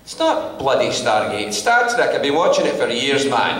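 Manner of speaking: loud, stubborn Scottish argument; stubborn Scottish dad. Retold or imitated. Father arguing it's Star Trek, stubborn Scottish voice. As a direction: dig in, won't be told.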